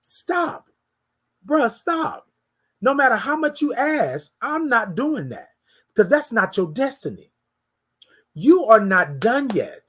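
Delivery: a stern God cutting off a prayer; God's firm reply. Retold or imitated. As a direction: stop him short as God would. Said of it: "The answer was quick and swift".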